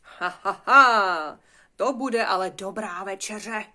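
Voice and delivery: loud mock-gleeful "ha, ha, haaa"; gloating laugh. Wolf gloating over his coming dinner, gleeful. Gloating character before a meal.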